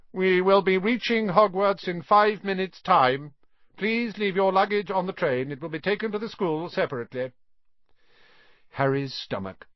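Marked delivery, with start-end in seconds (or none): 0.1-7.3 high, projected announcement voice; train announcer. A narrator's reading. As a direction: announce it to a whole train in a raised pitch. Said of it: "A voice echoed through the train".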